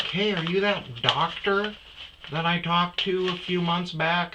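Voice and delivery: high chirpy woman imitation; curious gym lady. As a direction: pitch it up like a chirpy stranger. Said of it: "Or she's like".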